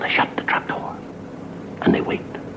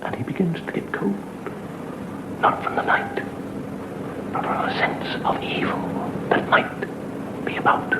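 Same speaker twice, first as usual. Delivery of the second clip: hushed, slow ghost-story whisper; spooky whisper; 12 semitones higher, 5 dB quieter than usual. Acted. Storyteller whispering the growing dread to audience. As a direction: breathe it like a secret.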